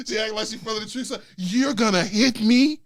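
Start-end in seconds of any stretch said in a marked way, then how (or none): 1.4-2.8 slow, drawn-out incredulous challenge; louder, slowed-down disbelief, acting out an affronted mother; stretched-out mock indignation; mock outraged mom. Mother's outrage voiced in story.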